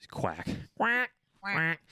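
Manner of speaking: high playful duck quacks. Retold or imitated. Joking about cooking, high duck quacks.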